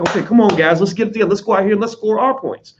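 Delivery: rally the huddle to get moving; team rally. Acted. Good athletes regrouping after losing points, determined rally. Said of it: "You look at their face".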